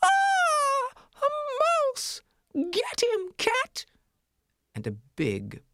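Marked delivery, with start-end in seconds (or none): 0.0-3.8 very high, panicked scream; lady of the house shrieking at a mouse, terrified; woman screaming. Acted. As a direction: shriek for the cat in fright. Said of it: "a woman screamed".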